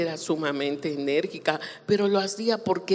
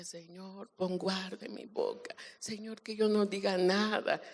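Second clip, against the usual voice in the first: very soft, pleading prayer; hushed appeal to God; retold prayer; 6 dB quieter than usual. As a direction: pray under your breath for restraint. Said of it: "yo oraba, decía".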